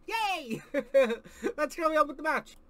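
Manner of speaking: ordinary talk, nothing marked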